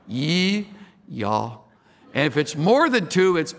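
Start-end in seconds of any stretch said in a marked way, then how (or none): 0.1-1.6 "Ye" unrounded, then "y'all" with no L; Southern pronouns. Shown as a demonstration. Native drilling "Ye" and "y'all" in Southern pronunciation. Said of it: "Here's how you say it".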